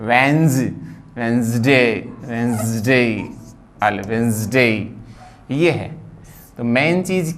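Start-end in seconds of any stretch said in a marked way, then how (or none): 0.1-4.7 वेंसडे in two syllables with a buzzed z before de; correct pronunciation. Shown as a demonstration. Teacher repeats the right form, two-syllable वेंसडे. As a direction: say वेंसडे as venz-de, no middle vowel. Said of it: "अब इसको देख लो".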